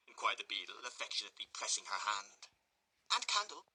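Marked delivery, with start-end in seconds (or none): 3.1-3.6 high, coy lady's voice; coy matron. Acted. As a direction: answer coyly.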